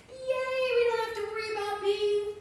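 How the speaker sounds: high, quick excited cheer; mock crowd cheer.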